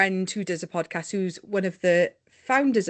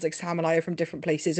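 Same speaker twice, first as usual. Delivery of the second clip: ordinary talk, nothing marked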